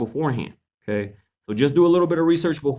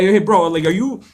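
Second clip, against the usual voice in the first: confronting call-out; 6 semitones higher, 5 dB louder than usual. Retold or imitated. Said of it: "I'd be like".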